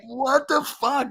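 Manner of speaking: high, slow disbelieving cry; incredulous outburst. Retold or imitated. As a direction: pitch it way up in shocked disbelief. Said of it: "and I'm like".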